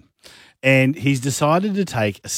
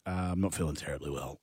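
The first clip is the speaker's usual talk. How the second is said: fake sick voice; 7 semitones lower, 15 dB quieter, much faster than usual. Faking sick on phone to boss.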